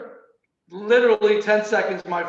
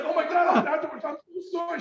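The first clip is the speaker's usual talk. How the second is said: flustered apology; 9 semitones higher, faster than usual. Retold or imitated. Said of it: "it's like".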